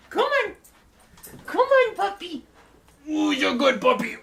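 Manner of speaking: very high, loud calls to the dog; puppy-calling voice. Real, not acted. Streamer calling puppy Hazel to stand, high excited calls. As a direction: coax the dog up with squeaky cheer.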